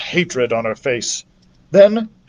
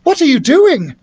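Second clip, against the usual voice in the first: put-on young girl voice; 12 semitones higher, 4 dB louder than usual. A narrator's reading. Narrator voices young character.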